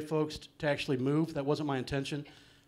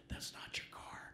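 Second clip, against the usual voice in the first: hushed secret whisper; whispered aside at the ceremony; 18 dB quieter than usual. Retold or imitated. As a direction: lean in and whisper it. Guy leans in, secret aside.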